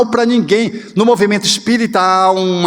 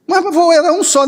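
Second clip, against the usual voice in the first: put-on high, pleading little child voice; child protesting; 9 semitones higher than usual. Grandchild protests to grandpa about the dead chick, high and pleading. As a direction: play the grandchild, hurt and insistent.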